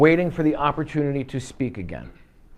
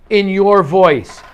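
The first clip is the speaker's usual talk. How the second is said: loud, higher, slow "In your voice"; emphatic rule; 6 semitones higher, 9 dB louder, much slower than usual. Real, not acted. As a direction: hammer out "In your voice" word by word. Teacher stressing a rule.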